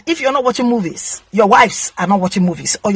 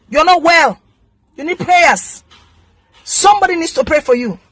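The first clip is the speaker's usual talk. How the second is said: real anger, pitched high, scolding; indignant telling-off at a higher pitch; very angry; 6 semitones higher than usual. Real, not acted. Fed-up vlogger telling off meddlers in her industry, very angry. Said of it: "I'm very angry right now".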